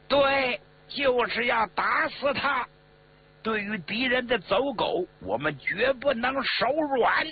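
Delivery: high, emphatic speech to a crowd; high-pitched hardline oratory; rallying declaration. Acted. Leader endorses the crowd's death demand.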